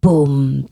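deep, loud boom; booming footsteps. A narrator's reading. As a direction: stomp it out like a giant's step. Giant footsteps.

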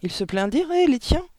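high, challenging comeback; retort. A narrator's reading. Hortense answers Mathilda's question, retorting. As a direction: throw the question straight back. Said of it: "riposta Hortense".

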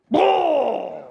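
a loud, grating off-key squawk. Acted. The bird that sings so off-key it frightens itself, squawking. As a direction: belt one awful out-of-tune note.

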